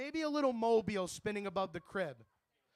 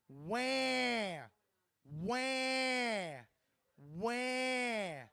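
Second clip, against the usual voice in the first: baby crying imitation; 2 semitones higher, much slower than usual. Comic mimicking a baby crying in the movie theater, mock wail. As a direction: cry like a newborn in the cinema.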